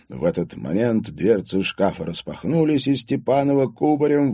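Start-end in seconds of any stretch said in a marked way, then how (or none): none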